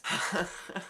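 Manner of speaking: short breathy real laugh; amazed laugh. Real, not acted. Reviewer floored by the verse he read, amazed laugh. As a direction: laugh in disbelief.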